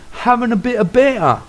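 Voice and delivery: put-on high, chirpy English accent; British banter voice. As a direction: say it like a cheeky Brit. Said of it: "they would even say".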